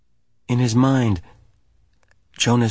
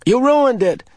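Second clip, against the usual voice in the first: high, louder irritated snap; 7 dB louder than usual. A narrator's reading. Asher annoyed at Jonas for spoiling the game. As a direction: throw the blame at him. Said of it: "in an irritated voice".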